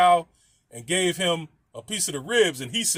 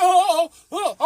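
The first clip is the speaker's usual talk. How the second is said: high, louder mock moans from the neighbor; mock moaning; 10 semitones higher, 7 dB louder than usual. Imitating the neighbor heard upstairs, high mock moaning. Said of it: "she was like".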